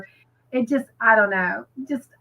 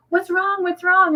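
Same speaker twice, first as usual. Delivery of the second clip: higher-pitched mock alarm; quick, high, worried imitation; imitating woken husband; 8 semitones higher than usual. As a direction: act out a husband startled from sleep. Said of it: "he wakes up and he's like".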